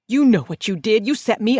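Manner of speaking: furious accusation through the phone; hissed accusation. A narrator's reading. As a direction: hurl the blame at her.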